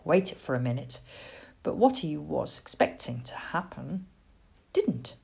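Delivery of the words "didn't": ordinary delivery, nothing marked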